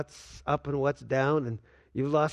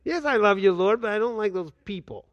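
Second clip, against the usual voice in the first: louder complaint; 4 semitones higher, 4 dB louder than usual. Acted. Believer answers, complaining.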